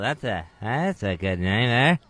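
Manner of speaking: halting low impression, trailing down; amateur celebrity impression, words caught in the throat; Norm MacDonald. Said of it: "That's my Norm MacDonald impression".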